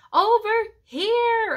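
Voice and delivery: high, loud, slow call; yell. A narrator's reading. Hamster signals to the searchers, yelling. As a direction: yell it up high, stretching each word. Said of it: "I yelled".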